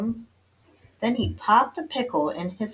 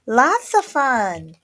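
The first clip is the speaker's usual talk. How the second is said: higher, loud, drawn-out agreement; agreeing little sister; 6 semitones higher, 8 dB louder, slower than usual. A narrator's reading. Second kitty sister agrees, chiming in slowly. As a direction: chime in with stretched-out relish. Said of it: "agreed Jilly Boo".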